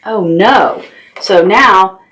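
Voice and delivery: ordinary delivery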